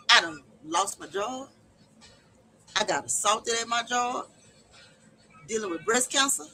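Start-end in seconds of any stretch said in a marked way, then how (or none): 0.1-1.5 near tears, voice cracking; voice breaking. Real, not acted. Telling viewers her troubles, voice breaking. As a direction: let the voice crack on the loss.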